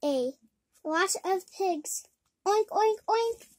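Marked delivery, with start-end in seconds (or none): 2.5-3.4 pig oinks. Pretend. Pig noises while reading.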